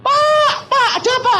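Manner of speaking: very high, loud-ending panicked voice; hammed-up housemaid shrieking in alarm; alarmed maid. Housemaid waking the boss about stolen goods, alarmed. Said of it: "pembantunya bangun bilang".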